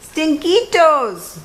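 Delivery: high, drawn-out call with Spanish flair; playful hollered greeting. A narrator's reading. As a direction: call out to hidden friends. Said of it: "called out Skippy John Jones".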